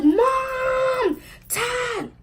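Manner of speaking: high-pitched hammed-up call to Mom and Dad; mock yell for parents. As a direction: shriek for your parents like a tattling sister. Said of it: "she goes yelling and running".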